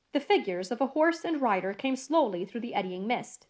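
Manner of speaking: ordinary delivery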